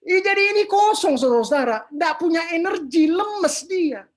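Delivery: seru lantang bernada tinggi; seruan gemas. Real, not acted. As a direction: berseru tinggi dan keras kepada jemaat. Berseru menjelaskan penyebab kepada jemaat. (seruan gemas, lantang)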